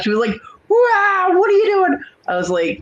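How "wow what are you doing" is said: very high-pitched, mock outraged teen voice; startled daughter. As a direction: squeal it as the startled daughter, imitating her. Daughter reacts to a slap.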